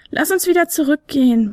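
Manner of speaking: a tiny flute hearing something; little flute Fionella. Acted. Fionella urging retreat.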